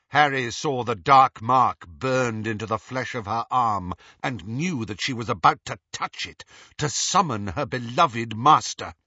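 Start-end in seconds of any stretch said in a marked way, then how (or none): none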